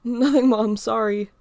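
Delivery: loud call up the house, then quick apology; calling back to mom. Acted. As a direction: shout it back to mom, a little sheepish. Answering mom across the house.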